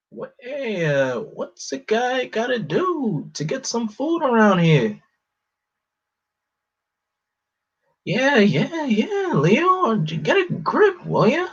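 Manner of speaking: Raphael impression. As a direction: play the grumpy turtle demanding food. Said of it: "that's my Raphael impression".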